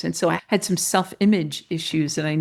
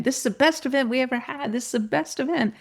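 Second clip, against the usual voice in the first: high, quick crowd chatter; high-pitched, fast quote of others' praise; retold repeated chorus; 6 semitones higher, faster than usual. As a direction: rattle it off lightly as everyone's refrain. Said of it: "they kept saying over and over again".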